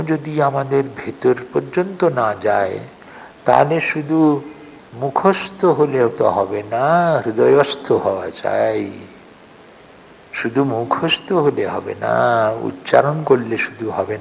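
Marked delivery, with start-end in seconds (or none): none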